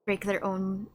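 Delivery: ordinary talk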